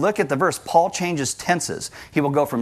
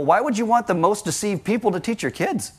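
usual speaking voice

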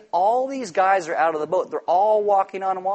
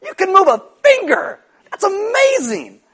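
much higher, loud, slow celebration; enthusiastic cheer; 9 dB louder, slower than usual. Retold or imitated. God cheering on a struggling believer, in a hammed-up shout. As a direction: cheer wildly at the smallest step. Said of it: "And God's like".

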